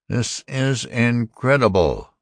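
high, slow robot disbelief; incredulous old robot. A narrator's reading. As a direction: voice an ancient robot, affronted and slow. Ancient robot objects to the human.

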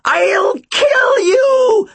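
very high, drawn-out crazed wail; screamed threat. A narrator's reading. Killer screaming at the boy in darkness, wailing. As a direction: shriek it like an inhuman wail. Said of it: "Charon screamed".

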